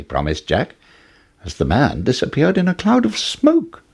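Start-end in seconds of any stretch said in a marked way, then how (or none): none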